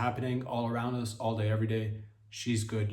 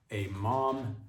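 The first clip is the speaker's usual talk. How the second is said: ordinary talk, nothing marked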